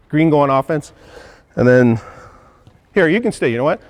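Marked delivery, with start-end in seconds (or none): 1.6-3.8 breathless from demonstrating drills; out of breath. Real, not acted. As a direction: talk while still catching breath. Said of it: "I'm getting out of breath".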